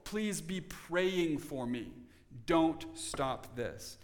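slow, deliberate appeal; slow and measured entreaty; ongoing plea. Acted. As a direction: draw each word out, insistently. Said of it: "it is an ongoing, continual".

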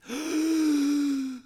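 high sharp gasp of shock; a mother's startled gasp, acted; imitated gasp. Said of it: "My mom gasped".

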